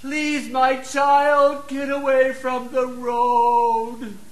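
soft, slow mock pleading with the child; too-soft plea. Shown as a demonstration. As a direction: ask it gently, far too sweetly. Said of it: "Do you think they're gonna get their attention?".

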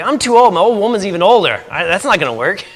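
hammed-up grumble of an old man; old Abraham complaining. Abraham complaining in retold story.

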